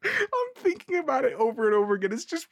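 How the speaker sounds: stifled giggles. Real, not acted. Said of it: "I'm trying not to laugh too hard".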